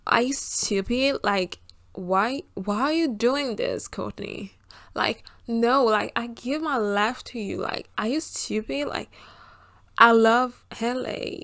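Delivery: exaggerated American girl, drawn-out words; American girl accent. Shown as a demonstration. French vlogger playing the American girl, hammed-up American accent. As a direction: ham up an American accent, slow and indignant. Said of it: "je fais trop l'américaine".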